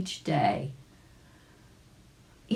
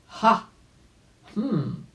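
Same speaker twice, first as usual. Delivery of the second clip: wise doctor's exclamation; 6 semitones higher, 3 dB louder than usual. Acted. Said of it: "exclaimed the doctor".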